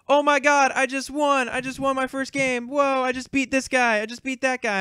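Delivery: put-on high, excited kid crowing over a win; mock squealing victory gloat. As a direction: imitate an overexcited kid bragging in a high voice. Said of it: "going around screaming at the top of your lungs".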